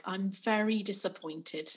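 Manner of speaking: slow, measured parental reproach; acted grave disappointment, slow and deliberate; disappointed parent. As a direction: draw it out like a controlled telling-off. Parent voiced scolding a child.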